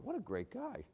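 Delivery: high, soft admiring exclamation; mock voice of approving neighbors; admiring ethnics. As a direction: gush it like an impressed neighbor. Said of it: "all the ethnics would go".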